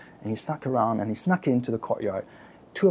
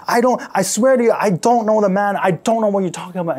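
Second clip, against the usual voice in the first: loud, high, frantic swearing; desperate denial; 6 semitones higher, 7 dB louder than usual. Acted. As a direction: swear it out in panic. Peter swears denial surrounded.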